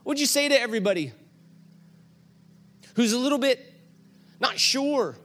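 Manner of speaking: high, eager coaxing questions; urging her over to speak; bright eager coaxing; excited asking. Retold or imitated. Pastor voices eager question to Cammie.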